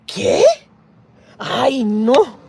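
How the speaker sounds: high, loud gasp and ¿Qué?; mock shocked gasp. Neighbour gasps at how close the kids' ages are. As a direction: overplay scandalised disbelief.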